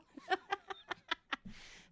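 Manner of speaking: high burst of laughter; real high laugh. Real, not acted. Laughing at husband's deadpan reply. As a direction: laugh hard at his comeback.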